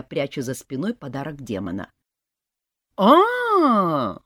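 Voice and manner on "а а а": high loud wailing scream; old woman's outraged yell; granny's scream. A narrator's reading. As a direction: howl as if robbed. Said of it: "заорала бабка".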